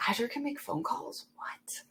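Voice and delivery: whispered, stunned disbelief; astonished whisper. Retold or imitated. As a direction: hush it like you can't believe it. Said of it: "and then we're like".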